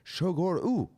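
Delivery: Shogor ooh, high, tacked-on ooh; mock Japanese pronunciation. Host mocking how Japanese add an ooh, mock Japanese accent. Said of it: "they can do it".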